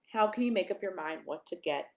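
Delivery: ordinary delivery, nothing marked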